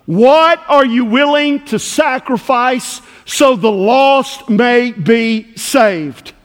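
impassioned pulpit appeal; forceful preaching. Real, not acted. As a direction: press the challenge hard, drawing out the last words.

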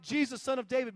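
loud, high call to Jesus; imitated shouting. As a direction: act out the beggar shouting over a crowd. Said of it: "But he's shouting it".

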